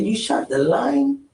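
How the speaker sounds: slow, high, stunned question; incredulous. Retold or imitated. Stunned, he asks his friend if he really shot the line. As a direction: drag it out in disbelief.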